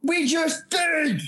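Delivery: high, slow frustrated yell; exasperated shout. Real, not acted. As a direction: shout it out in disbelief. Exasperated yell at muffled friend.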